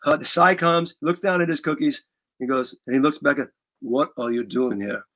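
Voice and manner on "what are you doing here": low, soft, slow rumbling rock star voice. Retold or imitated. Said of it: "You do a good imitation of [name]".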